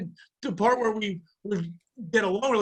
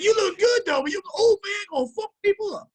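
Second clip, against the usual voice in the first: higher mock Irish accent, amused; 12 semitones higher, 3 dB louder than usual. Coworkers react to his age in Irish accent. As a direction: lean into a put-on Irish accent. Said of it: "they were obviously Irish with the accent".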